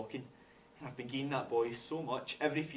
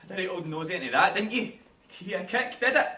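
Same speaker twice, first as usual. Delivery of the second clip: loud, accusing and higher; angry; 7 semitones higher, 9 dB louder than usual. Acted. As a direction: tear into him, loud and hurt. Angry confrontation.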